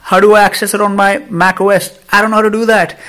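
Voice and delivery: high, loud, exasperated ranting; animated rant. Real, not acted. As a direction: vent your frustration at full pitch. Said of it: "I'm animated, I know".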